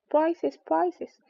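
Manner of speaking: high, loud clamouring; a crowd's eager cry; calling out. A narrator's reading. The party crowding Alice, calling out high and loud. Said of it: "calling out in a confused way".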